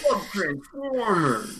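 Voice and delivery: silly clown. Pretend. Echoing the goofy Transformers bit.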